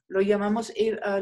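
ordinary delivery

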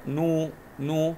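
نو drawn out as a long "nuu"; long vowel. Shown as a demonstration. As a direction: hold the u of نو. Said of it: "مد بالواو".

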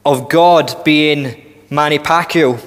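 ordinary talk, nothing marked

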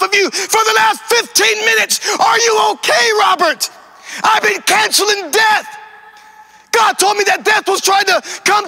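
frantic, high-pitched shouting; screaming mama. Retold or imitated. Praying mother phones her son to check he is okay, screaming. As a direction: scream it out in desperate alarm. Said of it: "She's crying and she's screaming".

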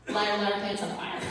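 chuckling singsong taunt at herself; laughing self-mockery. Real, not acted. Mocking her own claim to the congregation, laughing.

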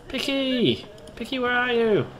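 high, carrying call. Pretend. Player searching for Picky, calling out. Calls out to game character.

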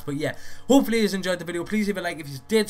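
rushed, energetic outro line; talking fast. Real, not acted. Vlogger rushing his sign-off to viewers, fast and upbeat. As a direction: rattle it off quickly and brightly. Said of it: "Sorry for talking so fast".

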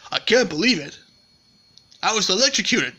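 ordinary talk, nothing marked

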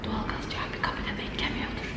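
hushed worried whisper. Real, not acted. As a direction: whisper it under your breath. Worried aside, unvoiced.

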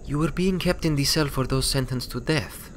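calm rebel mare explaining, quiet and grave; lightly voiced Materi. A narrator's reading. Materi explains to Twilight why they waited, softly serious.

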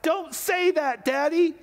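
high-pitched, strained retelling shout; yelled back. Retold or imitated. Son yelling back at his dad, high pleading shout. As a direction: build into a high childlike protest. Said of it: "yelled back".

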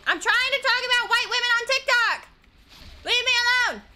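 high, loud complaint; exasperated yell. Real, not acted. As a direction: yell to be left alone, exasperated. Pleading while interrupted mid-topic.

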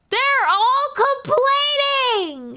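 very high, very slow, stretched-out whine; high, slow complaining wail; whiny mock voice. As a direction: drag it out like a sulking child. Mocking whining customers.

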